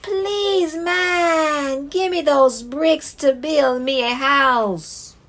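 high squeaky piglet voice; small pleading pig; little pig voice. Acted. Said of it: "and said".

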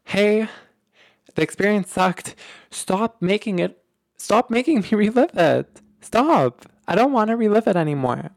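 fed up, ordering the brain to stop; telling off your brain. Pretend. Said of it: "you just wanna tell your brain".

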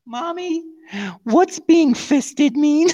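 put-on high, slow kid's question; innocent child voice. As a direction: ask it like a curious little kid. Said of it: "child asking mother".

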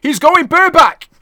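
very high, loud commentator shout; mock-alarmed full-voice holler; Heenan's cry. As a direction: yell it out like a scandalised ringside announcer. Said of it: "and Heenan cries".

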